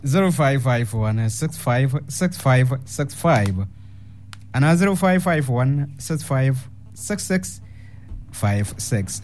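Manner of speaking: slow, measured number read-out; phone number announcement. Real, not acted. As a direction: announce the digits slowly, one by one. Reads out call-in phone numbers.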